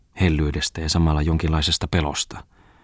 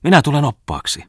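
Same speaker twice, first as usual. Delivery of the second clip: higher, louder teasing offer; playful; 10 semitones higher, 5 dB louder than usual. A narrator's reading. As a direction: toss it off playfully, much higher. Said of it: "sanoi silloin pianisti leikittelevästi".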